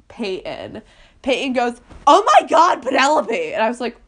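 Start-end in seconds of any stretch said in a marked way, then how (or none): 2.1-3.5 high, loud imitation of a shout; excited call-out. Older kid calling Penelope over with news, excited shout. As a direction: shout the name across the room.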